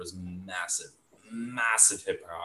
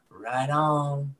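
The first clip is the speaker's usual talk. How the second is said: mock hippie; 6 semitones higher than usual. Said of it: "they'd go".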